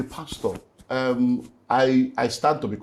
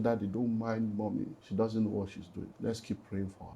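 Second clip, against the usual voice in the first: put-on soft child's words; 5 semitones lower, 15 dB quieter than usual. Father quoting his eight-year-old daughter.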